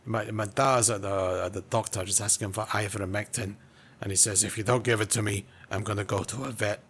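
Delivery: put-on Scottish storytelling; mock Scottish character voice; Scottish accent. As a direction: relate it in amateur Scottish. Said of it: "I could probably try a bit of Scottish".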